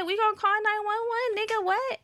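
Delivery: high-pitched incredulous comeback; retold high, exasperated reply; exasperated retort. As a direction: pitch it way up, fed up. Retold exasperated answer to friend.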